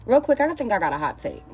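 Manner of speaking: ordinary talk, nothing marked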